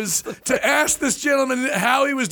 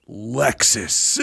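contemptuous scoff; 12 semitones lower than usual. Retold or imitated. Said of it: "and goes, Pfft".

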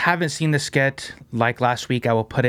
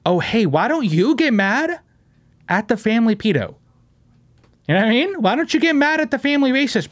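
jab back at the critics, voice rising; venting at commenters, rising pitch; heated rant; 7 semitones higher than usual. Real, not acted.

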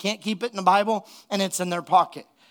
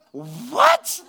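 stunned what; 5 semitones higher, 3 dB louder than usual. Retold or imitated. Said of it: "she's like".

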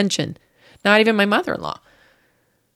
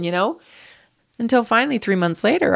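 normal talking voice

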